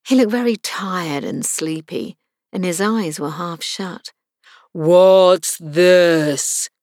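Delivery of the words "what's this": loud, slow, booming lion; deep hollow tone. A narrator's reading. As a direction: toll it out like a great bell. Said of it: "speaking in a deep, hollow tone".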